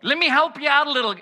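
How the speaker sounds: loud, fast fumbling pitch; mock nervous Moses. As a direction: stammer then rush the offer. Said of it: "He's like".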